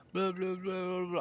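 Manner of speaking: high, soft mumbled babble; mock mumbling. Coach mimicking the student's unheard calls, mock mumbling. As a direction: run the blahs together in a quiet, high mumble. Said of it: "you're just mumbling".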